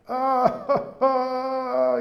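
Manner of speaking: long high-pitched mock wail; mock crying wail. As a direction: wail out a drawn-out, acted sob. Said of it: "Reb Zusha starts to cry".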